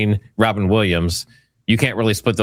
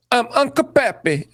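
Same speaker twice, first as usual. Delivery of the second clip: mimicking an over-the-top character voice, much higher; hammed-up character intro, pitched way up; mocking overacting. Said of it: "So you can see the overacting".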